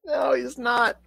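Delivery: mock crying. Mock despair over movie confusion.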